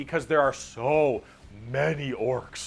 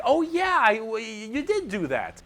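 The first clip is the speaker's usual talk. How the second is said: much higher, sheepish dim orc; 5 semitones higher than usual. Pretend. Grunts sheepishly agreeing with their boss. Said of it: "all the other orcs are like".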